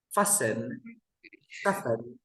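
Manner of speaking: fasten said as fassen, no T; silent T. Shown as a demonstration. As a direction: model fasten slowly, dropping the T. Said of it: "We don't pronounce the T".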